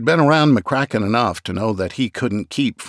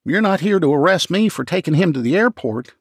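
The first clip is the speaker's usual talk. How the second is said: raised, anxious man's voice; lightly raised voice of a worried clerk; McCracken's voice; 7 semitones higher than usual. Acted. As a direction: play the nervous office man, pitched up.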